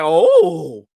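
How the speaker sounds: slow, breathy amazement at first sight; stunned awe. Retold or imitated. Friend retelling first sight of Tony, stunned awe. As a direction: gape at him in amazement. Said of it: "and I was like".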